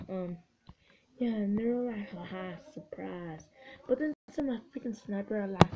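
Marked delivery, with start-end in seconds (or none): 2.1-3.4 soft, low aside as the mines; low, understated taunt; sly voiced quote. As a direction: drop down low and quiet for the ambush. Voicing units that ambush.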